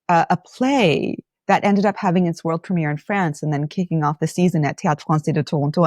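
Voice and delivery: usual speaking voice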